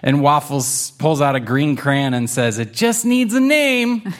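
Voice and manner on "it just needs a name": high, eager little kitten. Acted. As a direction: pipe up like a tiny kitten. Waffles voiced as a kitten.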